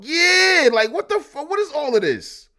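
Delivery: high, loud, incredulous outburst; loud disbelief. Acted. Husband confronting what the book says, high loud disbelief. Mock outrage over the book's praise.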